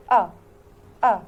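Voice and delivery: أ as a light short e, like a lax vowel; teacher's short e demo; short e sound. Shown as a demonstration. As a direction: say أ short and open, barely a vowel. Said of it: "الساوند بتاعه اسمه أ".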